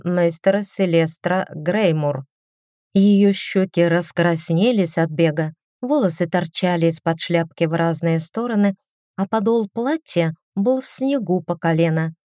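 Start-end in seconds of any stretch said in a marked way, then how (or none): none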